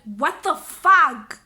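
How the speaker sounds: loud, slow, drawn-out irritation; irritated. Retold or imitated. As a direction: stretch it out loudly, fed up. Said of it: "I was so irritated".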